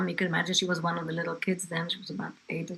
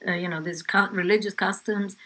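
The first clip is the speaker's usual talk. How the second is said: normal talking voice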